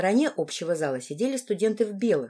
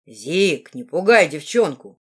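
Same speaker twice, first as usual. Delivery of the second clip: lower, louder male character voice; half-dwarf friend; 3 semitones lower, 7 dB louder, slower than usual. A narrator's reading. Half-dwarf telling his friend to stop, character voice. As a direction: tell a friend off in a deeper voice.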